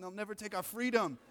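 high-pitched, bad Scottish accent; amateur Braveheart-style Scottish accent; terrible Scottish accent. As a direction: do a badly faked Scottish battle speech. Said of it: "that was a terrible accent".

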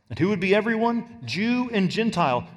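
ordinary talk, nothing marked

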